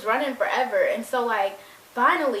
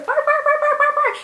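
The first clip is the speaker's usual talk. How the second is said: yappy barking; 6 dB louder than usual. Retold or imitated. Imitating the chihuahuas barking.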